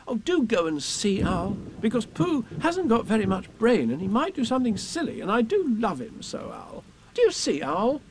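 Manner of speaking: put-on high, anxious boy; Christopher Robin pleading. A narrator's reading. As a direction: beg earnestly in a little boy's voice.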